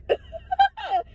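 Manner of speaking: shrieking giggle; real squealing laugh; high squealing laugh. Real, not acted. Laughing at Scorpios.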